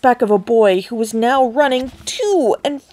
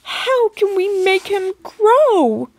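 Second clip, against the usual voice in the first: much higher, tearful wailing; 10 semitones higher, slower than usual. A narrator's reading. Mother pleading with Wonka, tearful wail. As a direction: wail to him near tears. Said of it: "wailed Missus T V".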